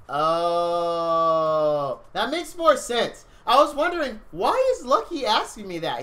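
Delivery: high, loud sudden realization; surprised realization. Real, not acted. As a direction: it finally clicks, say it loud and high. Realizing what a viewer meant.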